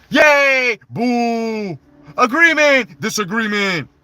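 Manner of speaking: loud high cheering and booing; mock fan cheers. Showing what fans do, loud mock cheers. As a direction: shout like a crowd of fans.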